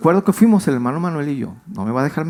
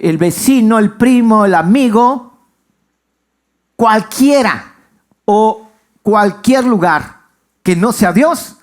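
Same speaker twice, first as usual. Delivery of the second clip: louder, higher list of people, rising in force; fervent pulpit build to a peak; impassioned preaching; 5 semitones higher, 5 dB louder, slower than usual. Acted. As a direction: preach it out to the whole church, building with each name. Pastor preaching at full force.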